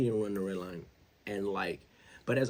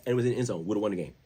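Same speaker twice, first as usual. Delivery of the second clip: ordinary talk, nothing marked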